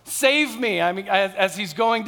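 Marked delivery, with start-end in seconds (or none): 0.0-0.8 slow, louder plea, higher pitched; a mild reenacted cry for rescue; crying out for help. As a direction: call out to the Lord as you sink. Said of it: "he cried out".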